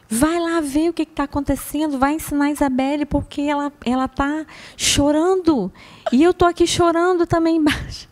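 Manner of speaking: mild imitated plea; pleading grandmother. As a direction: beg her to go help.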